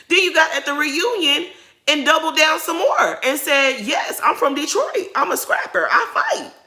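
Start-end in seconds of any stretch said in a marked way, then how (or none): 3.8-6.5 higher, rising mock imitation of a proud boast; mocking Kenya. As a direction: ham up her tough-girl brag.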